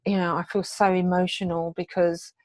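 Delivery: ordinary talk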